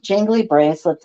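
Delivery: plain reading voice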